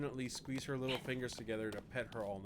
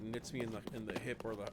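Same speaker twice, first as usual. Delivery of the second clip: usual speaking voice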